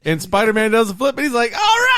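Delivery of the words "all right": high, loud whoop of approval; imitated cheer. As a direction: cheer like a delighted onlooker. Acting out bystander's delighted cheer.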